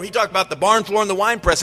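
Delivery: ordinary talk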